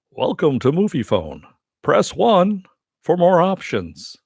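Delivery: slow automated phone announcer; hammed-up recorded phone menu; Movie Phone voice. Shown as a demonstration. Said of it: "a Movie Phone impersonation".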